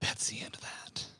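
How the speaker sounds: soft whisper. Real, not acted. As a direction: whisper it to yourself. Repeated, then whispered.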